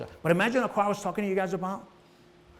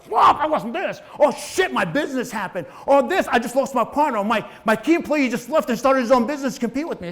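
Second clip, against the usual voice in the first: higher, louder mock panic over losses; panicked outburst; 7 semitones higher, 4 dB louder than usual. A business owner panics after a bad deal. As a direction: blurt out the losses in alarm.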